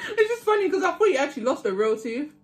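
laughing. Real, not acted. Laughing while explaining.

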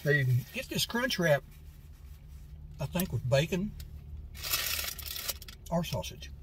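speaking with food in mouth; muffled words between bites; talking while chewing. Real, not acted. Seen 3: bites wrap just before, chews and wipes mouth while talking.

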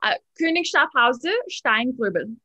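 "Königschaft Hause Steingrubel" in a German accent, rolled and crisp; German-accented reading of the winery name. Shown as a demonstration. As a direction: say "Steingrubel" as a German would, with a sh sound. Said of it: "I love your accent there".

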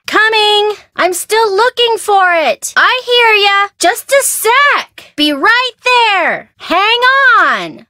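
high, loud call to someone far off. Acted. Bess calling out to someone at the door, loud and high. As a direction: shout it across the house.

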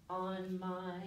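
ordinary delivery

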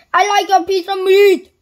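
loud, high booming shout. A narrator's reading. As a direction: bellow the order across the shop. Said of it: "boomed Mister Noisy".

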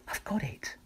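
high soft whispered realisation; a hushed sudden idea; tiny snail whispering eureka; snail's idea. A narrator's reading. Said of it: "she cried".

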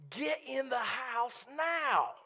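high, slow shouted command, NOW hit hard; urgent shout. Retold or imitated. Father shouting at a teen to get to safety, urgent. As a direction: yell it so she finally moves. Urgent order with raccoon nearby.

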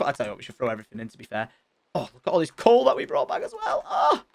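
ordinary talk

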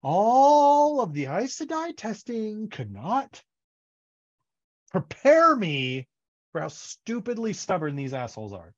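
venting about stubborn villagers, mock fury; exasperated rant. Moiraine complaining about Two Rivers folk, exasperated. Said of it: "She's just like".

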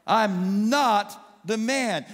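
drawn out, every word hammered; "I'm not the man", stretched wide; slow emphatic declaration. Acted.

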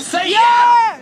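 excited crowd call. Real, not acted. Performer to crowd, shouting for a yeah. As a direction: shout it high and loud. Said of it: "make it loud".

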